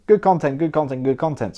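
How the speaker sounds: "good content" looped, each one sinking a little; repetitive parroting. Retold or imitated. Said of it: "repeating myself, going".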